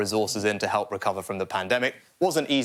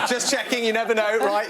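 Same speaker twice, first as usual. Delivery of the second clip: laughing; 8 semitones higher than usual. Real, not acted. Joke about mentioning his parents.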